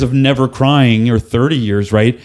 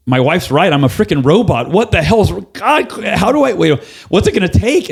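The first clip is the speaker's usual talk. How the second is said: rising higher and higher in exasperation; frustrated self-talk; 8 semitones higher than usual. Retold or imitated. To himself, desperate to cry, mounting frustration. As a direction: climb into a strained, near-breaking pitch. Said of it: "And I'm like".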